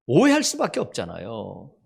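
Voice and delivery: usual speaking voice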